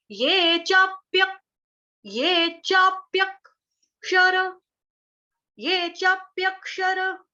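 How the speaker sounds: slow, careful ये चाप्यक with half क; chanting teacher demo; half ka, chapyak. Shown as a demonstration. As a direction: sound out चाप्यक slowly, half क joined to य. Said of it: "क्या बोलना है हमको".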